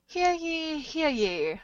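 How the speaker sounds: put-on town crier call in a British accent; drawn-out, falling British-accented proclamation; attempted British accent. Co-host redoing the host's meeting opening, attempted British accent. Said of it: "I don't know how I would do".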